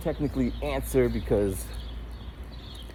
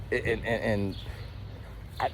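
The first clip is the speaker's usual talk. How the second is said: ordinary talk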